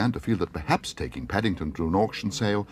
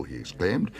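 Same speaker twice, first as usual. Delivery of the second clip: plain reading voice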